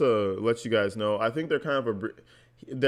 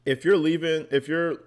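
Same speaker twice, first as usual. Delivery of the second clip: heated; 6 semitones higher, 3 dB louder than usual. Real, not acted. Heated rant at absent woman.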